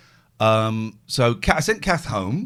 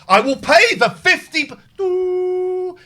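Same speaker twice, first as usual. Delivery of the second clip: loud, high yelling that breaks off; furious outburst cut short; angry shout; 8 dB louder, much slower than usual. Retold or imitated. Customer shouting at the agent, cut off to hold. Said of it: "I'm getting angry and I'm shouting".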